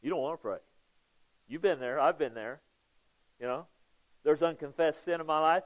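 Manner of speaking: ordinary talk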